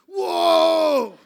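high, very loud whoa; hammed-up cry of amazement; amazed shout. Son gets up after prayer, amazed.

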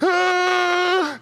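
high pained shout of agony; pained yelp. Retold or imitated. Lifter whose shoulders just tore, high pained yelp. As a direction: yelp as both shoulders rip. Shoulders tear under the weight.

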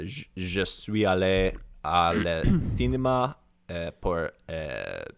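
halting attempt at French; hesitant French accent. Shown as a demonstration. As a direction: feel your way slowly through French, French accent. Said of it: "with a French accent".

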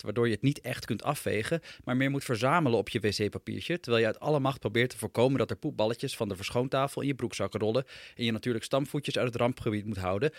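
plain reading voice

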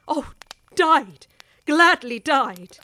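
loud, slow, impassioned cry; passionate outcry. Acted. Woman swearing to the wife she would die first, loud and slow. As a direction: throw the words out with full passion.

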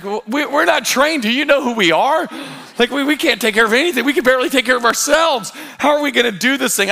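comic panic, stammering at the start; retold panic. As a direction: act out overwhelmed new parents. Retold panicking new parents at discharge.

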